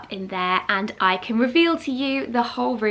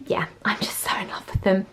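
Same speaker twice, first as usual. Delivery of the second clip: whispering; 6 dB quieter than usual. Real, not acted. Gushing about the tiles.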